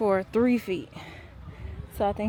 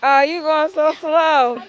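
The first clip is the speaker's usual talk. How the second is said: calling from far away; 6 semitones higher, 11 dB louder than usual. Real, not acted. Mom calling to kid on slide.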